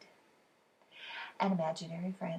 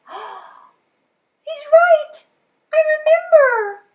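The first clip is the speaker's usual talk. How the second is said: much higher and louder, thrilled; excited shout; 16 dB louder, much slower than usual. Acted. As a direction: burst out in delight. Dad remembers blaster.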